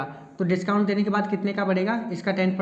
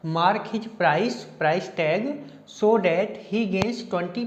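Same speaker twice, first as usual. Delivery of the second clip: slow reading aloud; much slower than usual. Shown as a demonstration. Reading the question aloud slowly.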